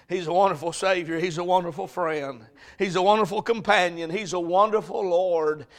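ordinary delivery

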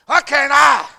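"Why can't I?" yelled high and loud; loud, high cry of "Why can't I?"; shouted question. Acted. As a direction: hurl "Why can't I?" out in protest. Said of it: "repeated question, second shouted".

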